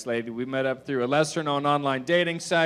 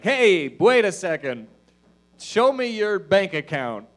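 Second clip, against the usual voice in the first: slow, louder gatekeeper challenge; stern doorman; 6 semitones higher, 6 dB louder than usual. Retold or imitated. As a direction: block the door like a bouncer. Imagined doorman stops him.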